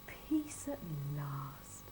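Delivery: slow, soft sigh of relief; relieved whisper. A narrator's reading. Mister Bear finally in bed, soft slow whisper. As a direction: breathe it out, sleepy. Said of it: "he said to himself".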